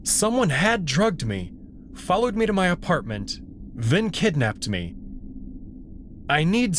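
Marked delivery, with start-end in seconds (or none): none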